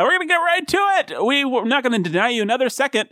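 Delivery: very high, rushing announcer patter; hyped show intro. Pretend. Host promises listeners an immediate start, hyped announcer. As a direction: launch in with over-the-top host energy.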